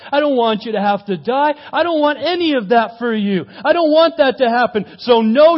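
high, loud, insistent refusal; desperate protest. Acted. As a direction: build from pleading to a forceful refusal. Peter pleading, then refusing, acted.

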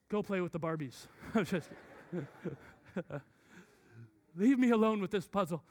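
ordinary talk

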